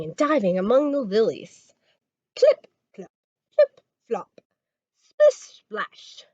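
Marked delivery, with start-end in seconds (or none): 2.4-6.2 playful high splish splash; high frog sounds. Acted. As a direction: bounce it up high like frogs diving. Frogs splashing sound words.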